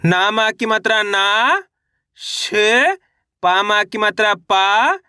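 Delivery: slow reading drill, each final syllable held and rising; syllable-by-syllable demo. Shown as a demonstration. Teacher showing learners how to pronounce the word, syllable by syllable. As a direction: spell out the vowel sign, then stretch the syllable. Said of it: "अब उच्चारण कैसे करेंगे".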